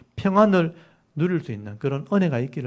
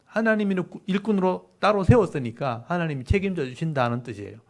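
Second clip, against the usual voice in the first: ordinary talk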